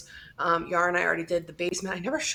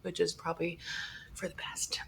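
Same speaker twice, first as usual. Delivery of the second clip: whispering; 11 dB quieter than usual. Real, not acted. Whispered aside.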